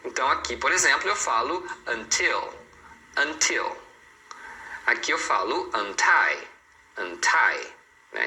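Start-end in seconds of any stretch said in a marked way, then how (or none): 1.9-3.8 until with a clear T; T pronounced. Shown as a demonstration. Teacher models an exception, the T in until pronounced. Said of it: "você tem que falar". 5.8-7.7 untie with a clear T on tie; T pronounced. Shown as a demonstration. Teacher models an exception, the T in untie pronounced. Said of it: "não tem como não falar".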